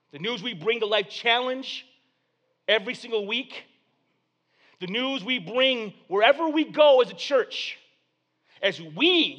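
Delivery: high, loud sermon delivery; preacher driving the point home; preaching. Acted. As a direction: build the congregation up. Preaching at full force.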